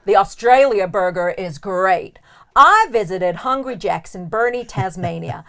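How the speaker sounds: hammed-up American accent; shaky American accent. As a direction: read it as an American tourist, accent slightly off. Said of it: "Sorry for my slightly shit accent".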